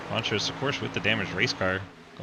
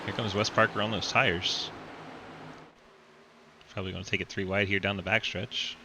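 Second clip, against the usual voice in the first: race commentary. Acted. Live race play-by-play.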